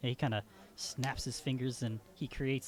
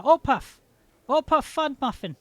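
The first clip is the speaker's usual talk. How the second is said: higher, louder full name; proud announcement; 11 semitones higher, 10 dB louder than usual. Acted. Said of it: "He's like".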